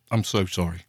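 chewing while talking; mouth full. Real, not acted. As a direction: talk through a mouthful. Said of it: "this is disgusting".

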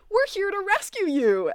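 squeaky eager rescue; imitating eager Andy. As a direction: mimic a keen sidekick chirping the line. Andy's eager rescue line, high.

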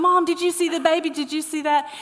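high, loud, excited questions to mom; excited kids. Retold or imitated. Children running up to mom after her ride, excited imitation. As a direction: imitate thrilled kids calling out. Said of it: "they are laughing".

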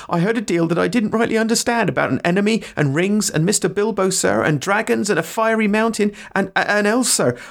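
high, eager rustic voice; high-pitched breathless confession of wonder; dithering servant. Acted. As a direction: blurt it out as a humble, starstruck gardener. Sam confesses, begs to see elves.

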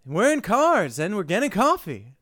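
high, loud, excited pitch-guy voice; Jerry Seinfeld. Retold or imitated. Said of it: "Jerry Seinfeld just being like".

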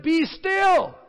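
high, loud and slow proclamation; commanding Moses. Retold or imitated. Moses commanding the people to stand firm. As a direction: proclaim it over the crowd, drawn out. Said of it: "He said".

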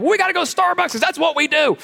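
high whiny complaint; mock whiny churchgoer. Churchgoer in the car insists on Starbucks, high mock whine. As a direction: whine about needing Starbucks. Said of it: "you're like".

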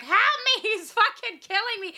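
high squeaky panicked plea; hammed-up little girl plea. Mock victim plea in mall.

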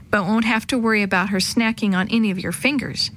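ordinary delivery

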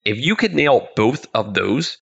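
ordinary talk

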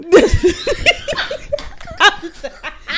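high burst of laughter. Real, not acted. Laughing at the memory.

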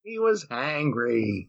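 slow, drawn-out repeat; slow deadpan. Real, not acted. Repeating the hangry joke slowly as a deadpan callback. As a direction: drag out the punchline.

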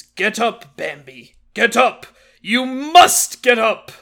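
slow, grave commanding stag; solemn father voice. Retold or imitated. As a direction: urge him up with gravitas. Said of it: "He brings the".

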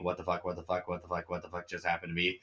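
rapid, hushed run of disbelief; softly babbled mock shock; stunned muttering. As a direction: mutter it fast and low, over and over. Imagined swordsman reacting to his sword.

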